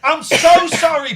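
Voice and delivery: loud, high, pleading shout of sorry; shouted apology. Real, not acted. Host shouting an apology to listener Paul. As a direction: bellow the apology across the room.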